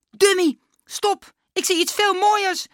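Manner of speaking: high, loud excited interruption; calling out. A narrator's reading. Klaas stopping Dummy's raving, excited high shout. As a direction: cut in with an excited high cry. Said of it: "riep Klaas".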